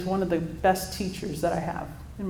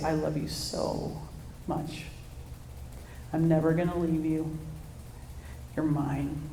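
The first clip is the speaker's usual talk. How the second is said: soft, close, hushed words; hushed secrets; 6 dB quieter than usual. Retold or imitated. Dad softly telling his newborn good secrets. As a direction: murmur it like secrets into a baby's ear. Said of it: "put his mouth just, like, really close to her ear".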